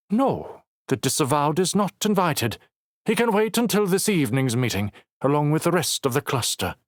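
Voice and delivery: high, cold dismissive voice; disdainful councilwoman. Acted. Councilwoman dismissing Knox to Logan, high disdain. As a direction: look down on him from a height.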